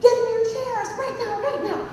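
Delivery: high, frantic captain's orders; mock scream over the intercom; imitated screaming. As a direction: mimic a panicked pilot shouting orders. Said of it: "he was screaming".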